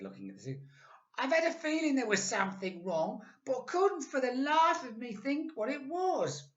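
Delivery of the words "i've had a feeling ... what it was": put-on very high, squeaky old woman; Missus Twit. A narrator's reading. Old wife puzzled by her stick, high and squeaky. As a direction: squeak it out like a startled old hag. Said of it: "Missus Twit said".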